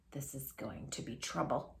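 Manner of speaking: whispered, nervous and breathless. A narrator's reading. Dog whispering nervously to himself. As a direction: whisper it as if afraid to be heard. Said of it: "he said to himself nervously".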